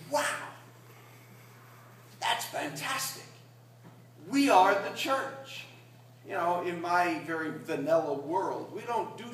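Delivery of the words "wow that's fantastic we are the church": high, wondering, slowing down; amazed exclaim. Retold or imitated. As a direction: priest recalls his own amazement, high and slow. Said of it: "And I said".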